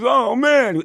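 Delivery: high, loud "oh man" of a startled friend; mock surprise. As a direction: play the friend caught off guard. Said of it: "He goes".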